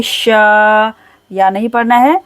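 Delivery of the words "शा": शा with a long held aa; lengthened, level शा; stretched shaa. Shown as a demonstration. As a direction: draw out the vowel of शा. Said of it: "खींच कर पढ़ेंगे".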